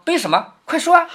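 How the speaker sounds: amused prodding, rising high at the end; laughing urging. Acted. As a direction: egg him on through a laugh. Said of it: "冯子英笑着说". (笑着催促)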